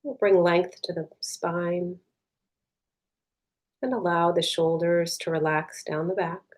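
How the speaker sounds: soft, unhurried meditation voice with long pauses; guided meditation. Real, not acted. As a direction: guide them gently inward, leaving space between phrases.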